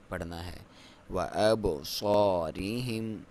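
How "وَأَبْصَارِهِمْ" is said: slow drawn-out tajweed chant; Quran recitation. Shown as a demonstration. Teacher recites the verse for students, slow chant. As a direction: recite it slowly with full tajweed. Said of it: "آپ نے کلکلا کرنا ہے".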